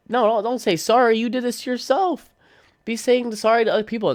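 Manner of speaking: high-pitched incredulous telling-off; mock scolding. Reacting to the friend's sorry, high mock scolding.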